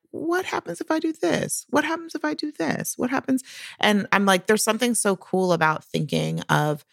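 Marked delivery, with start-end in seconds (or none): 0.1-3.4 high, eager wondering voice; curious Einstein. Retold or imitated. Imitating Einstein's eager, high wondering. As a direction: voice the curious scientist, pitched well up. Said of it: "he was like".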